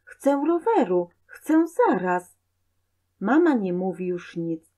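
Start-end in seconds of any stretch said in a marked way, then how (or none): 0.0-2.4 put-on high-pitched childish demand; stubborn whine, short of a scream; insistent child. A narrator's reading. Boy keeps demanding from mother, high and insistent. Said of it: "Przede wszystkim nie krzycz".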